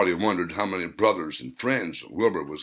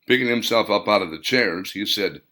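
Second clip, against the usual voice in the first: plain reading voice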